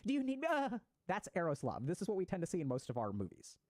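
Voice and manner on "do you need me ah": high, breathless gushing romance voice; gushing lover. Retold or imitated. Passionate lover gushing at their partner, pitched up and swooning. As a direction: swoon over the one you love. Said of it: "It's like".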